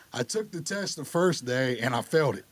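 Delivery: ordinary delivery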